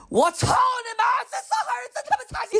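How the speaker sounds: furious swearing. Pretend. Imagined comeback cursing the Shanghai driver. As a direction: explode at the rude driver.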